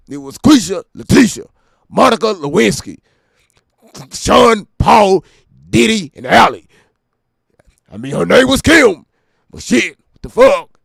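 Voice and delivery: shouting the names in a rapper bark; loud bark. Retold or imitated. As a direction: yell the list like a hype man.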